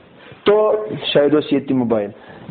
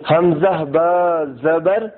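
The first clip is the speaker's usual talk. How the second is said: slow spelling drill; much slower than usual. Shown as a demonstration. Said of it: "spelling out the syllable".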